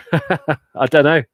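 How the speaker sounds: giggling at his own guess; laughing. Real, not acted. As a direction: laugh through it, drawn out.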